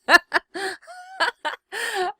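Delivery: high, loud burst of laughter at her own joke; real giggle; embarrassed laugh. Real, not acted. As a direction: laugh at yourself, caught off guard. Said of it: "I'm such an idiot".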